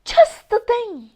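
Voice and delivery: high, eager exclamation; excited. Acted. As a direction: leap up with the idea. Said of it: "jumped to his feet in excitement".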